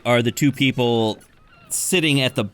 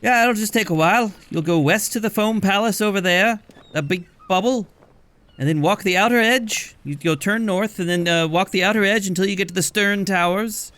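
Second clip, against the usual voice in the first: much higher voice giving directions; chatty barkeep; 8 semitones higher than usual. Acted. Barkeep gives long directions to Cat Salvage, high voice. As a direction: rattle off the route.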